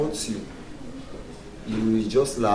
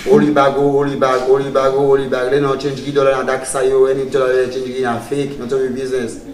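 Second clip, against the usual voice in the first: loud, rapid-fire hawker's chant; Salone airport man; 3 semitones higher, 9 dB louder than usual. Retold or imitated. Comedian mimics man at home airport, loud shouting voice. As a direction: belt it out as a repeated call over the crowd. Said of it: "na man voice here".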